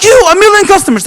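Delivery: can't believe this short guy; stunned disbelief. Retold or imitated. Speaker questioning the short guy, stunned disbelief. Disbelief at tall guy's claim.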